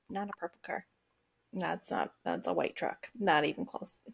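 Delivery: low, hushed, deflated mutter; muttering to herself. Retold or imitated. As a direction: mumble it softly, as if talking to yourself. Muttering to herself at passing cars.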